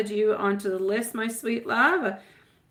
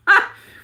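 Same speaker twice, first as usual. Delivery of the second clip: short laugh; 11 dB louder than usual. Real, not acted. Laughing at her own story.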